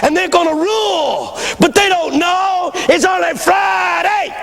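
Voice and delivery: high, drawn-out shouted sermon; full-cry preaching. Retold or imitated. Old pastor builds his Friday sermon, shouting high and slow. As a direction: thunder it high, stretching the key words.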